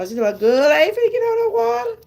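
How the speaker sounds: high fast squeal of refusal; mock-frightened high-pitched refusal; mock panicked friend. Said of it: "get my best friend and go".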